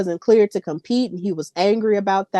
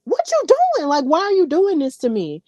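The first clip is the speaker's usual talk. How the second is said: much higher whiny mock plea; mock Trick Williams; 7 semitones higher than usual. Trick pleading with Carmelo, much higher mock whine. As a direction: pitch it way up like a hurt little plea. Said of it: "And Trick was like".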